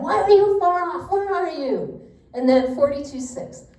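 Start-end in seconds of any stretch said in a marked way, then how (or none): 0.0-2.0 a high, loud cry of why and where; yelling at God. Retold or imitated. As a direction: throw the question at God like a yell. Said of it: "yelling at him".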